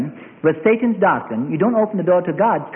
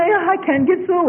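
much higher, whiny complaint; mock despairing believer; 11 semitones higher than usual. Preacher mimicking a worshipper who can't reach God, high and whiny. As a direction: moan it like you've given up.

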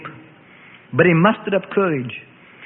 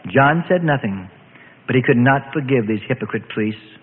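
plain reading voice

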